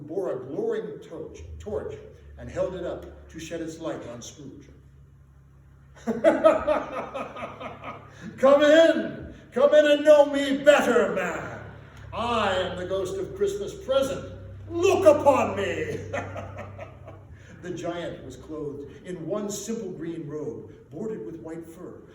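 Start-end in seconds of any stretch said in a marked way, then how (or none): 4.7-8.3 big booming laugh in a put-on voice; hearty giant laugh. Merry giant ghost laughing as Scrooge enters. 8.4-16.0 loud, high, booming jolly giant. Acted. Ghost of Christmas Present booming a welcome to Scrooge. 16.0-17.6 breathy giant chuckle. Acted. Giant laughs after greeting.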